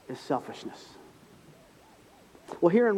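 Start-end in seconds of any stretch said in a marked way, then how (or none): 0.1-1.0 whispered punchline. Real, not acted. Punchline dropped to hush.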